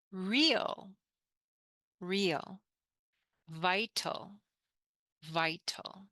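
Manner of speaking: slow, clear teacher model words; careful English teacher modelling; model pronunciation. Shown as a demonstration. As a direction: say each word twice for students to repeat. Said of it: "In English, we pronounce this".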